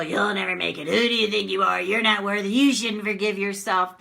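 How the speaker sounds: a hint of a cold critic, lightly voiced; mock bully sneering at you; understated nasty inner critic. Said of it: "That's the bully".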